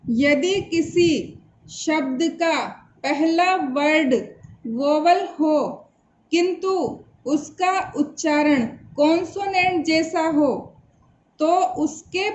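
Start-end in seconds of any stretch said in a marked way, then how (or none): none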